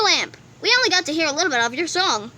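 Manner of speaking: normal talking voice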